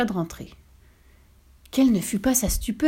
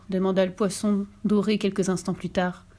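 ordinary delivery

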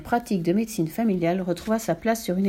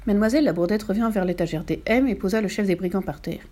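plain reading voice

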